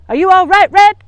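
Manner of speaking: loud high call across the room. Acted. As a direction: call out to check on her. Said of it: "called Grandma".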